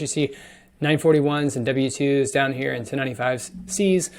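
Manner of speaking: ordinary delivery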